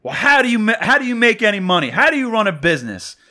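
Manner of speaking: high, loud, incredulous comeback; retold yell, giving it right back. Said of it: "as soon as I raised my voice".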